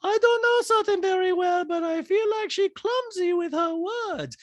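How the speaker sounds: very high put-on impression voice; Diana. Diana airing doubts about Sutton to everyone, high mocking impression. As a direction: mimic her high-pitched and loud, words stretched. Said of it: "Diana's on the sofa ... saying to everyone".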